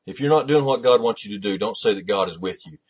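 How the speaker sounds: ordinary talk, nothing marked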